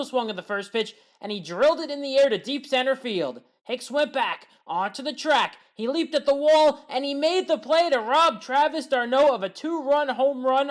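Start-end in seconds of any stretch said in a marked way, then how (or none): 5.7-10.7 rising, louder play-by-play as he leaps; higher, louder excited sports call. Real, not acted. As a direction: call the leap at the wall with a jolt of excitement. Home run robbed at the wall.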